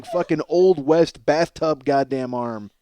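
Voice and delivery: usual speaking voice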